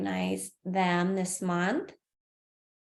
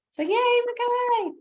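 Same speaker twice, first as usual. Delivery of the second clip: excited cheer; 4 dB louder than usual. Real, not acted. Cheering colleague at award.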